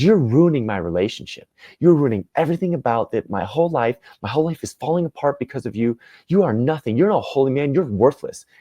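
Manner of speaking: high-pitched, heated accusations; enraged husband. Acted. Husband blaming the Buddha for his ruined marriage, heated. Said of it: "it just enraged, and he goes".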